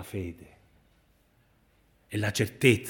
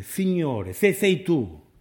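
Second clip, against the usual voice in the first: higher, rising-intensity appeal; acted plea, pitched up; calling out; 6 semitones higher, 4 dB louder, slower than usual. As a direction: call out to the Lord across the water. Voicing Peter calling out to Jesus.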